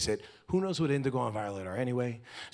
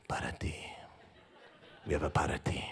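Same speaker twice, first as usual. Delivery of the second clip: a low, breathy whisper, much softer; whispered aside; 12 semitones lower, 7 dB quieter than usual. Retold or imitated. Husband muddling Spanish to his wife, mock whisper. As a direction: lean in and whisper it like a secret.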